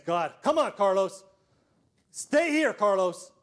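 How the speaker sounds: a loud, strained plea during chest compressions; desperate pleading. Retold or imitated. Principal giving CPR to Carlos, desperate loud pleading. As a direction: beg him to stay with you.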